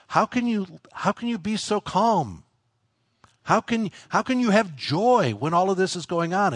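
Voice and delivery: high, incredulous questioning; amazed onlookers. Retold or imitated. Onlookers asking a calm believer, amazed and high. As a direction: marvel at the calm, pitch up. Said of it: "people are gonna say".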